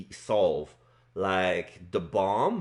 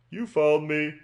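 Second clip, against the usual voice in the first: villain voice; 5 semitones higher than usual. Acted. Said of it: "So he's like".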